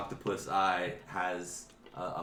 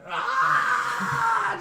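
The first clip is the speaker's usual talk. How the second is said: high, loud wail of pain; long piercing goblin shriek; pained goblin scream; 11 dB louder than usual. Acted. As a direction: scream in high-pitched agony. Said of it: "kind of Fezzik writhing in pain".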